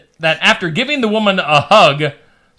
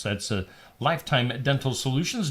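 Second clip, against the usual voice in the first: ordinary talk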